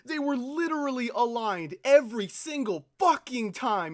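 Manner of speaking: loud angry complaint; furious player venting at the game; frustrated rant. Real, not acted. Raging at misaligned ships.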